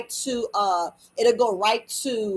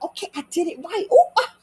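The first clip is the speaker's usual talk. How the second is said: delighted self-approval; 7 semitones higher, faster than usual. Acted. Acting out a parent who got it right, delighted. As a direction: squeal it in mock delight. Voices a proud parent's reaction.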